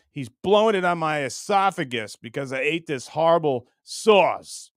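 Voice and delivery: normal talking voice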